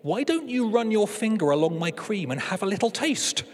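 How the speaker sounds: a hint of a seductive cake; coaxing cake. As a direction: tempt him like a cake offering itself. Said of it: "Cakey thought for a moment and said".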